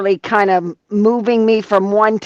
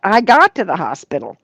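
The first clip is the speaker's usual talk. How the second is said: usual speaking voice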